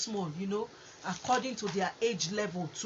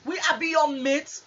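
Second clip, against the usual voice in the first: furious loud comeback, pitched high; angry shout; 7 semitones higher, 9 dB louder than usual. Retold or imitated. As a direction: snap back in outrage. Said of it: "the person go just flip like this".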